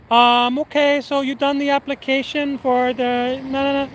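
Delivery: high, sing-out phone voice; manager impression. Manager calling about the application, high mock voice. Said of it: "He goes".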